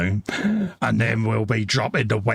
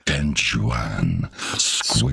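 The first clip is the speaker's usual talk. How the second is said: deep announcer voice; 10 semitones lower, much slower than usual. Pretend. Announcing model name in deep voice.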